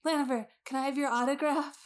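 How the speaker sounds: high, soft, rushed fan squeal; eager autograph seeker. Acted. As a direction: pester a star in a small high voice. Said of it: "she's like".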